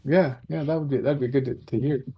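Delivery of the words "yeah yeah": usual speaking voice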